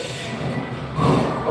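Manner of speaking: long, soft breath out; voiceless, drawn-out sigh of nerves; acted steadying exhale. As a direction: let out a slow breath to steady yourself. Steadying himself in retold moment.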